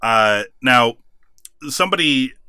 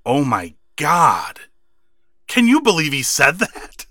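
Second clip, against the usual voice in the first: slow, hammed-up outrage climbing higher; mock upset announcer; 4 semitones higher than usual. Mocking the announcer's horror at the lisp insult, slow hammed-up outrage. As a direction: ham up the appalled announcer's disbelief. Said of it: "He was so upset".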